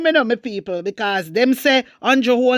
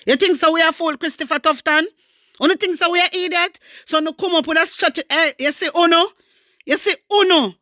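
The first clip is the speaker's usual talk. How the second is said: higher, fast, scornful challenge to the minister; indignant rant; 7 semitones higher, faster than usual. Real, not acted. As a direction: fire it at him, scornful and quick. Ranting at the health minister.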